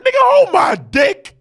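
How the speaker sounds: high, loud cocky taunt; mock Nick Saban. Coach Saban taunting Kirby Smart, cocky shout. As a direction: shout a swaggering boast at the loser. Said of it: "Nick Saban like".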